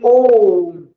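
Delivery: ओम with the O held long; drawn-out vowel. Shown as a demonstration. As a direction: stretch the vowel in ओम right out. Demonstrates long pluta vowel.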